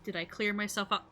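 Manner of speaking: normal talking voice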